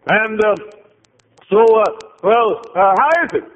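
high, loud, excited; eager questioning. Retold or imitated. Visitor quizzes receptionist about glory days, high and excited. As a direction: pepper her with eager questions.

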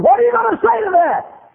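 very high, loud mimicked demand; shrieked challenge. Lady challenging the preacher to reply, shrieked. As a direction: demand an answer at a scream.

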